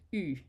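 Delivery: U said as a tight, narrow French u. Shown as a demonstration. Teacher models the narrow U sound. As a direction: round the lips tight for U. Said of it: "narrow that U sound".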